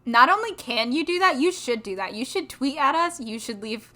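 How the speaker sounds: loud, eager push to the listeners; rally the audience loudly; loud urging. Real, not acted.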